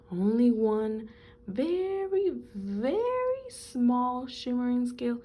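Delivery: slow, hesitant and musing; drawn-out wavering. Acted. Rainbow Fish wavers over giving one scale, slow and hesitant. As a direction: stretch the words as he wavers.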